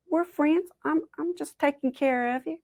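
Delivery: high-pitched, innocent reassurance; falsely friendly Rabbit. Acted. Rabbit feigns friendship to trick Possum.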